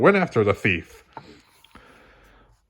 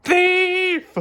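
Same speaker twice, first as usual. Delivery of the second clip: much higher piercing cry; shouted. A narrator's reading. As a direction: scream it across the mall.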